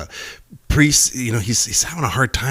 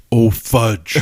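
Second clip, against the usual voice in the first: loud, booming God-like curse; big voice from heaven; 5 dB louder than usual. Acted. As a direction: play a big heavenly voice, breaking into laughter. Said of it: "here's this big voice from heaven".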